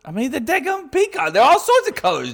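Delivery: exasperated. Real, not acted. Exasperated about peacock colors.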